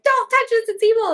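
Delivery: very high, comic warning; hammed-up high-pitched keep-away warning; mock warning. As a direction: squeal out the note like an alarm, playfully. Reads out retold warning note.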